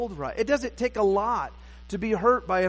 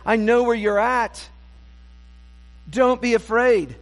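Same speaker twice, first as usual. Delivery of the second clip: slow, higher, louder plea; earnest encouragement; 5 semitones higher, 5 dB louder, slower than usual. Acted. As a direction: urge him gently but firmly. The Lord encouraging his servant.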